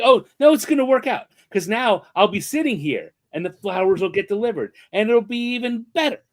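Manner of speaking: high, bright-eyed hopeful rush; eager self-reassurance. Retold or imitated.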